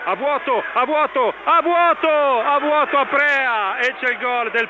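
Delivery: rising alarm. Real, not acted. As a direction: build the panic with every repeat. Repeated misses in the box.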